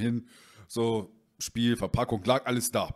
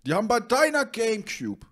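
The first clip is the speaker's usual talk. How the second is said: high, exaggerated mock awe; mock amazement; 13 semitones higher, 4 dB louder than usual. To the friend, hammed-up mock amazement at the laser. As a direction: ham up mock disbelief.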